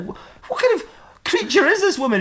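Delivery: very high-pitched disbelief; hammed-up bewilderment; astonished question. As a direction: gasp the question out, aghast. Acted astonishment at the story.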